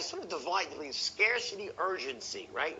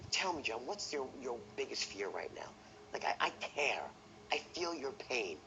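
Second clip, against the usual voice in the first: quiet, caring voice; sincere tonality; 4 semitones lower, 6 dB quieter than usual. Shown as a demonstration. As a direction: say it softly, as if you feel their pain. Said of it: "Your tonality of sincerity".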